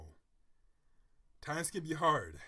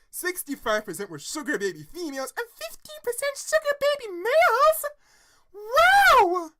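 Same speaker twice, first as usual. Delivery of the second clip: building to a loud, very high shriek; shocked disbelief; 14 dB louder than usual. Real, not acted. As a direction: read the figures with mounting astonishment. Shocked reaction to the statistics.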